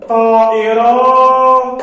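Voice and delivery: slow, loud, sing-song word; drawn-out word. Shown as a demonstration. Teacher reading a word to pupils, slow and sung. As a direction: stretch each syllable out for the class. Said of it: "الكلمة الثالثة لدي".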